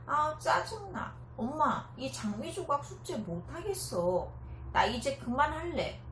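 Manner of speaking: put-on high, loud, fed-up kid complaining; whiny child. Child whines about homework. (징징대는 아이 목소리)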